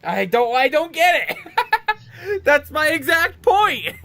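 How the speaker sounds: high, loud, drawn-out cry breaking into laughter; exasperated outburst. Real, not acted. Player baffled by the game's story, high exasperated outburst. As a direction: protest loudly, stretching the words way up high.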